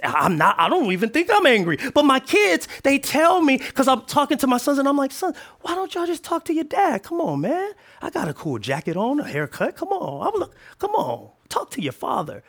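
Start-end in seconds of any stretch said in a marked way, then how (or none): 5.1-12.4 lightly voiced coaxing dad, pitched up; pleading dad. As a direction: coax the kids into talking to their dad. Father pleading with his sons.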